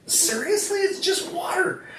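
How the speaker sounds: high-pitched, mock-indignant disbelief; mad disbelief. Husband recalling his reaction to his wife's purchase, mad disbelief. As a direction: act out exasperated incredulity in a high voice. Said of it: "I was mad".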